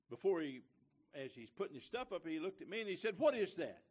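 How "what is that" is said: a loud, gruff demand from the stranger; gruff challenge. Retold or imitated. Stranger on the plane demanding about the pin, gruff. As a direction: bark the question like a suspicious stranger.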